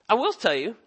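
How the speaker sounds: ordinary talk, nothing marked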